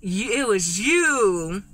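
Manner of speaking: slow, drawn-out dawning accusation; slow realization. Retold or imitated. Abby realizing Olivia freed him, slow stunned accusation. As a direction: let it dawn on you word by word. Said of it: "and Abby like".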